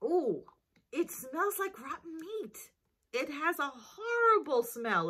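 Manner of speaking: disgusted, high whiny groan; smelling something awful. Real, not acted. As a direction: recoil from the stink, whining. Sniffs again, disgusted whine.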